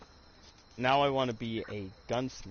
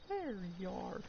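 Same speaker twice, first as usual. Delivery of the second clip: higher, softer menacing Southern voice; a hint of an angry Southerner; angry Southern customer; 8 semitones higher, 9 dB quieter than usual. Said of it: "an angry Southern person just runs into your store".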